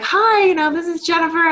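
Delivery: overexcited caller. Retold or imitated. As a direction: gush a hammed-up hello. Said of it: "you're like".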